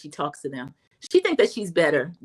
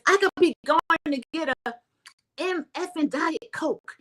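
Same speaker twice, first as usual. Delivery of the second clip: ranting Lisa; 8 semitones higher than usual. Retold or imitated. Vlogger mimics Lisa ranting about her drink, high.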